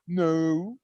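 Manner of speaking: put-on small shy boy's answer to the judge; meek little boy. Defendant answering the judge like a little boy, meek and soft. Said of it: "he like a little boy".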